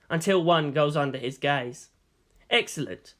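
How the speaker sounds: plain reading voice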